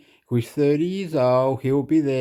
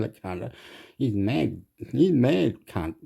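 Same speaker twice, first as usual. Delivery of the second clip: ordinary talk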